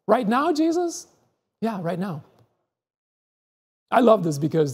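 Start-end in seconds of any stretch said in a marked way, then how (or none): 0.1-1.1 high, rising, disbelieving; incredulous question. Acted. Disciple answering Jesus's call, high incredulous question. 1.6-2.2 softer, settled reply; calm answer. Acted. Jesus answering the disciple, soft calm reassurance.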